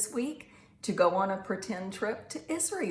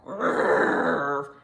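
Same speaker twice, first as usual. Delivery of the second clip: big, low, loud growl; louder growl; 7 semitones lower, 6 dB louder than usual. A narrator's reading. Storyteller as Clifford scaring mama bear, loud growl. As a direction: growl long and loud like a huge dog. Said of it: "an even louder growl".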